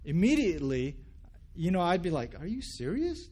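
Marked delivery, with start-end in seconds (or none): 2.4-3.3 high, incredulous question; offended disbelief. Pretend. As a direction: ask it in mock affront. Imagined offended listener reacting.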